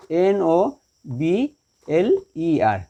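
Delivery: slow letters with gaps between; spelling out. Shown as a demonstration. Teacher spells the comparative for students, slowly letter by letter. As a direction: dictate each letter separately.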